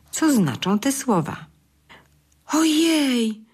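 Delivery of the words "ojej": high, sighing oh my; mild storybook astonishment; dazed realization. A narrator's reading. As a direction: gasp it out as it dawns on him. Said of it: "westchnął".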